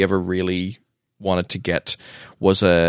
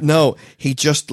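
normal talking voice

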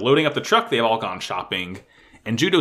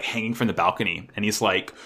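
ordinary talk, nothing marked

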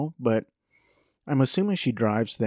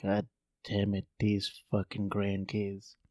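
low, very quiet, slow cursing; mock bitter grandma grumble; muttering grandma; 4 semitones lower, 10 dB quieter than usual. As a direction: curse under your breath, slowly.

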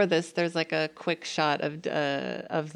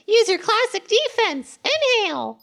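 very high, louder cartoon kid; Tiff voice; 6 dB louder than usual. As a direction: shriek it like an eager kid. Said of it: "It's like".

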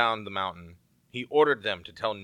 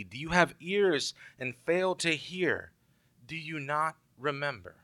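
frustrated Jesus; 6 semitones higher than usual. Retold or imitated. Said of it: "Jesus gets frustrated with them and says".

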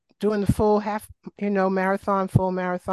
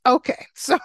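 laughing; 7 semitones higher, 3 dB louder than usual. Real, not acted. Laughing at the family joke.

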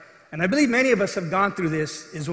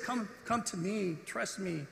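much softer, hushed reassurance; gentle invitation; 12 dB quieter, slower than usual. Retold or imitated. Jesus invites Peter onto the water, softly reassuring. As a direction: drop the volume right down and coax tenderly.

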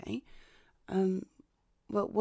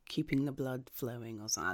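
usual speaking voice

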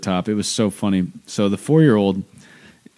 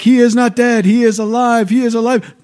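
put-on much higher and louder child preacher proclaiming; preaching child; 8 dB louder than usual. Mother imitating her son proclaiming the resurrection, high and loud. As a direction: cry it out like a tiny evangelist. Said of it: "he's like".

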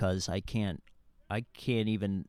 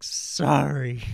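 hammed-up sob, loud and raised; mock sobbing; 4 semitones higher, 8 dB louder than usual. Mom apologising as she lets go of her son, mock sobbing. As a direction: imitate a weeping apology at full voice. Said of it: "She's, like, sobbing, like".

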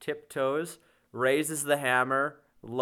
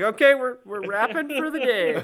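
higher and louder, laughing through a hurried wrap call; laughing alarm; 11 semitones higher, 10 dB louder than usual. Retold or imitated. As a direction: call it off fast, half panicked, half laughing. Actor raises the hammer for real.

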